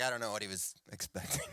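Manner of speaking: ordinary talk, nothing marked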